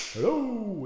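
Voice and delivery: hello ringing in an echoing room; big hall echo. Real, not acted. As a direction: call out to test the echo. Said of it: "I don't know if you can hear the echo in here".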